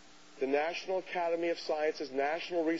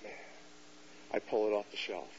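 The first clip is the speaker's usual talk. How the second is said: ordinary delivery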